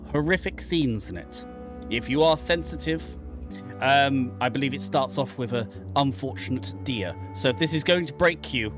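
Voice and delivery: ordinary talk, nothing marked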